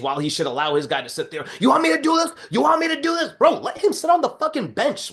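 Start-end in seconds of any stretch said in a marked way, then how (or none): 1.6-5.1 much higher, strained mock yell at a fighter; mocking a screaming trainer. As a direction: ham up a frantic corner man begging his boxer. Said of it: "he wasn't doing that shit".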